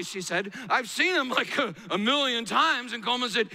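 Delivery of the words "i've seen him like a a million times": high, boastful child imitation; proud older sister. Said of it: "rather proudly".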